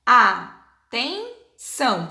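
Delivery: atenção in three separate beats, very slow; split into syllables. Shown as a demonstration. Teacher showing pupils syllable division, atenção slowly beat by beat. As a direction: break atenção into a-ten-ção with pauses. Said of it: "vai separar em três sílabas".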